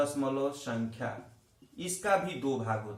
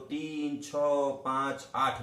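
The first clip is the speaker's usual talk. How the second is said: slow, each digit after दशमलव said separately: तीन छह पांच आठ; much slower than usual. Shown as a demonstration. Teacher showing students how to read decimals, slow digit by digit. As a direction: read the decimals out one digit at a time, slowly. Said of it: "एक एक अंक को लेके बोला जाता है".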